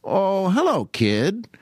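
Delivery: lightly voiced radio host greeting, falling off; drawn-out hello, dropping on kid; Jack Benny impression. Radio host answers his boy singer, lightly imitated. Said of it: "Benny would always say to him".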